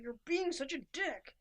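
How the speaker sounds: hammed-up indignant accusation; mock scolding. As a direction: imitate an exasperated scolding, stretching it out. Acts Clara scolding the Doctor.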